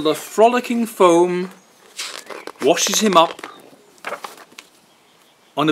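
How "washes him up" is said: usual speaking voice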